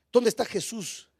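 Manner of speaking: ordinary talk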